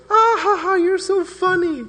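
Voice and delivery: mother laughing bitterly at Jesus; sarcastic grieving mother. Retold or imitated. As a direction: fake a shrill laugh at a tasteless joke. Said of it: "She goes".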